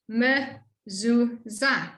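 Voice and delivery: "mezuzah" drawn out syllable by syllable; very slow, careful. Shown as a demonstration. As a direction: model "mezuzah" for the class. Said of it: "allows the mem to say its sound".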